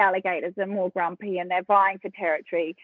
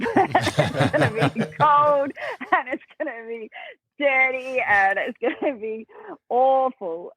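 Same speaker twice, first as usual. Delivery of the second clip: laughing through the words, higher and slower; 4 semitones higher than usual. Real, not acted. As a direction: giggle out the bad news slowly. Laughing while describing the swamp.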